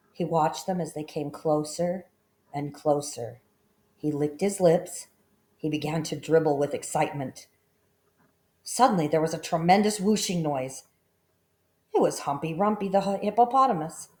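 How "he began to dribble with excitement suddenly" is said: plain reading voice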